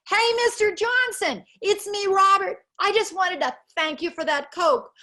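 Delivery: high, loud happy shout from a bus window; a boy leaning out of the bus calls to his teacher, high and loud; excited kid calling out. Retold or imitated. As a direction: call out like an overjoyed kid.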